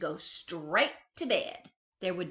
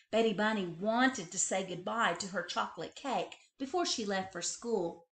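plain reading voice

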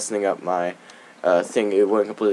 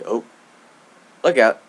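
ordinary talk, nothing marked

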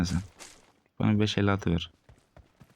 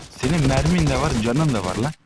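indignant jab; 5 semitones higher, 3 dB louder than usual. Real, not acted. Indignant at enemy.

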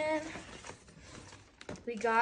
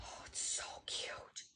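breathless hushed whisper, much softer; awed whisper; 14 dB quieter than usual. Real, not acted. Kid unboxing a gift package whispers in awe. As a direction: hush it like a precious secret. Kid gushing over a sports bra.

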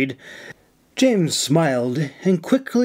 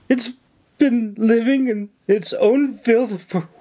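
high-pitched, put-on distress; worked-up lament; 8 semitones higher than usual. A narrator's reading. Nora working on the governor for help, lamenting. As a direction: pile on the woe. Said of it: "really working it up now".

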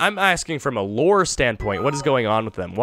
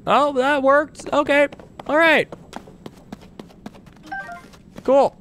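excited; 11 semitones higher than usual. Real, not acted. Trick shot succeeds.

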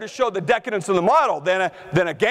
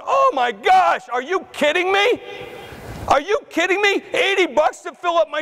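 mock outrage; 8 semitones higher than usual. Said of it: "You're going".

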